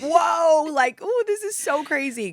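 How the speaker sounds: high, loud, gushing over a coffee shop; mock excitement. Gushing to her podcast guest about a London coffee shop. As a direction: squeal it like it's the best thing ever. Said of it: "I'm like".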